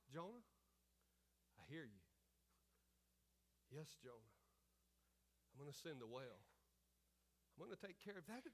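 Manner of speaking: soft, low voice with long pauses; tender reassurance. Acted. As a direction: gently reassure as the voice of God. Voices God reassuring Jonah.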